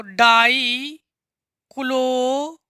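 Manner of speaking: very slow, broken into long syllables; drawn-out dictation. Shown as a demonstration. Teacher writing out the compound name, drawn-out dictation. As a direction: stretch each part, pausing to write.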